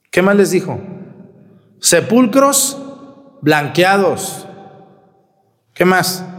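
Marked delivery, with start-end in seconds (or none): 1.8-4.5 very slow, high emphatic denunciation; forceful rebuke. Acted. Priest voicing Jesus denouncing hypocrites, slow and emphatic. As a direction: drag out each word as a rebuke. Quoting Jesus's harsh words.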